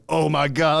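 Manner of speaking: mock panic, overwhelmed; a touch of retold panic; bugging out. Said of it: "I'm, like, bugging out".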